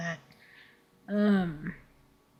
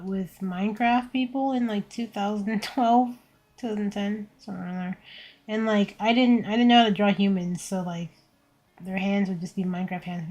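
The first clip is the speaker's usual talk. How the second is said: normal talking voice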